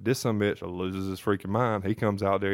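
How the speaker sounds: usual speaking voice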